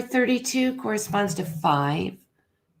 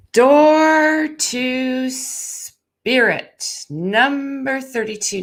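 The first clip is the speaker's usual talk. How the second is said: solemn announcement; 5 semitones higher, 4 dB louder, much slower than usual. Acted. Reading the drawn card aloud.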